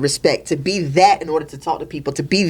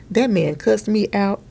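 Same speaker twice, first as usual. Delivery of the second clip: ordinary delivery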